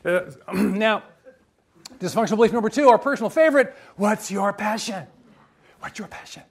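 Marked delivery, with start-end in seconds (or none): 4.0-5.1 drawn-out, teasing delivery of the question; slow mock question. Mocking the clichéd passion question. 5.8-6.4 breathy, hushed whisper of the question; mock whisper. Mocking the clichéd passion question.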